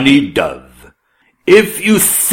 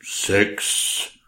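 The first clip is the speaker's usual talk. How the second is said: whispered; 3 semitones lower, 10 dB quieter than usual. Acted. King asks in a whisper.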